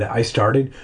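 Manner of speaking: plain reading voice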